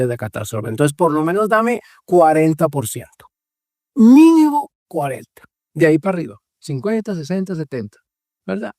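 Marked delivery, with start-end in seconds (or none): 3.9-5.4 higher and louder, emphatic; firm stress on Mínimo; insistent. Real, not acted. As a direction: push hard for the minimum. Insisting on her share of blame.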